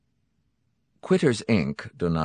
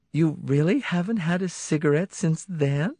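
high, incredulous question; surprised wife voice; 10 semitones higher than usual. Acted. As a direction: doubt him with surprise. Cindy voiced by narrator.

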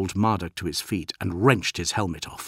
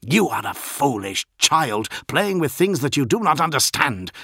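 louder snarling rebuke, rising in pitch; angry snarl; 6 semitones higher, 5 dB louder than usual. A narrator's reading. Captain berates a foolish pupil, snarling. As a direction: snarl it like a furious master. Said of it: "Bel Ashered snarled".

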